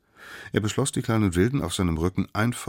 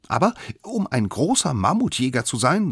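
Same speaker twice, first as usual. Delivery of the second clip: higher, self-assured little know-it-all; kindlicher Angeber; 9 semitones higher, 5 dB louder than usual. Acted. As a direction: play the smallest one, important and pitched up. Youngest figure speaks in story. (kleiner Besserwisser, hohe Stimme)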